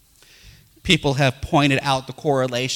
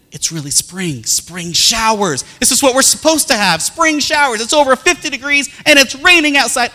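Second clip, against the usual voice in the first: excited daughter; 9 semitones higher, 5 dB louder than usual. Retold or imitated. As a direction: gush like an eager little girl. Daughter gushing.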